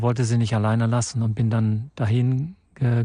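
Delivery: ordinary delivery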